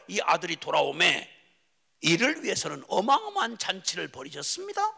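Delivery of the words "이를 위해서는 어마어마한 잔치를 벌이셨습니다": usual speaking voice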